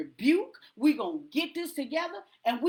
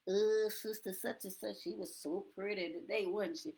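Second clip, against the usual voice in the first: low, hushed gossip voice; whisper-gossip about a sister, much softer; gossiping churchgoer; 5 semitones lower, 9 dB quieter than usual. Retold or imitated. Imitating gossip after service.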